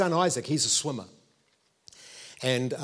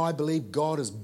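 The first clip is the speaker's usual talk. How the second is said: ordinary delivery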